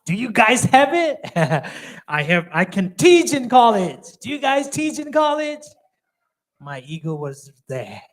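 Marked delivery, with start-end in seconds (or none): 0.1-5.6 1 arrogant boast. Retold or imitated. Mocking his past ego.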